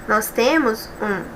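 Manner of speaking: ordinary talk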